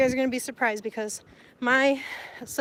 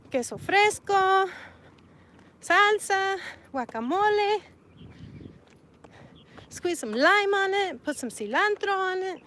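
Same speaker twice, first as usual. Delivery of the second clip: higher, slow, relishing each topping; savoring the food; 7 semitones higher than usual. Real, not acted. Describing toppings to viewers, drooling over them. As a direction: make the listener's mouth water. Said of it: "I'm making myself hungry".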